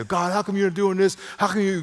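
high, insistent complaint to God; complaining. Acted. Believer asking God how come, complaining. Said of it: "we complain".

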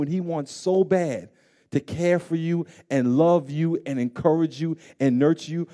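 ordinary talk

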